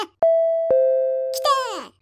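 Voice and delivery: long, drawn-out cry of arrival; excited cry. Acted. Nervous host as his girlfriend arrives, excited cry. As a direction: stretch it out in one excited shout.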